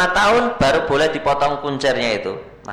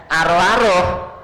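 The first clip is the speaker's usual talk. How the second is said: fussing grandma; 4 semitones higher, 3 dB louder, slower than usual. Retold or imitated.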